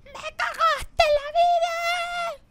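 high, drawn-out mock scream; stretched-out hammed-up yell of heartbreak; retold scream. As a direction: shriek it like the betrayed girlfriend. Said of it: "ella grita después".

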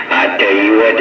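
usual speaking voice

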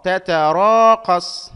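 loud, very slow "تتراقص"; drawn-out long vowel. Shown as a demonstration. Teacher analysing the word for students, drawn-out long vowel. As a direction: stretch "را" in "تتراقص". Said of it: "مد مع ممدود".

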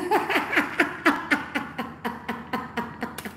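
long airy wheeze of laughter; breathless laugh. Real, not acted. Host laughing at the rival's followers, airy wheeze.

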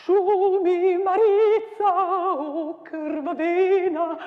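declaimed with wild swings of pitch and loudness; dramatic poem recital. Acted. Teacher performing the battle poem for students, declaimed.

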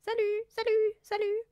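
high-pitched parrot voice; squawking parrot. A narrator's reading. Parrot greeting Fred and Mathilda, squawking high. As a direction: cry it out like a parrot. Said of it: "le perroquet cria".

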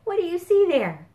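high, fast excited question; excited reading. Acted. Librarian shows the kids the picture, excited and high. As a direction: point at the picture eagerly.

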